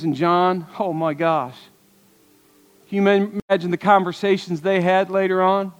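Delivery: ordinary delivery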